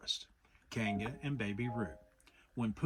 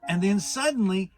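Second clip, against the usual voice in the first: higher, louder, fussy Rabbit; a self-important rabbit holding forth; Rabbit, pitched up and loud; 8 semitones higher, 11 dB louder than usual. A narrator's reading. Rabbit voiced in story.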